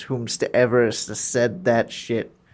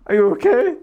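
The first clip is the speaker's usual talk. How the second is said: high, louder squeaky "are you okay"; high mock concern; 10 semitones higher, 5 dB louder than usual. Player imitates his own odd high question to angry enemies. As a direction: ask it in a silly high voice like a nutcase. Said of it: "just like".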